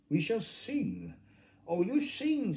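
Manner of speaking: high, excited calls of the Gryphon; high, excited creature voices. Acted. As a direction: squeak it out high and loud, like an excited fairy-tale creature. Mock Turtle and Gryphon voiced.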